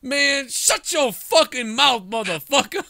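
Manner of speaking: high, slow mock yell; imagined angry veteran. Veteran telling the kid off, high drawn-out mock rage. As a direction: drag out the hollered put-down. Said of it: "if that guy was like".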